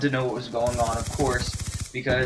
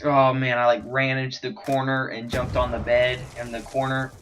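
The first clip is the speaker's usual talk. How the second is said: normal talking voice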